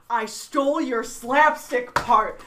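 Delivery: loud, slow, drawn-out admission; mock confession. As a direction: announce the theft with relish. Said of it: "first words out of his mouth".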